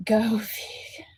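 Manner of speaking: whispered jab; hushed sarcastic dig. Real, not acted. Muttered jab at the parent.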